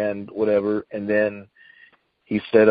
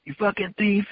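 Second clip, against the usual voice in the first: retold yelling; 10 semitones higher than usual. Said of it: "calling him names and stuff".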